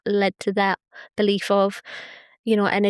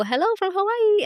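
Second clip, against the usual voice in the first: high voiced quote; 11 semitones higher than usual. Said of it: "people from Oahu go".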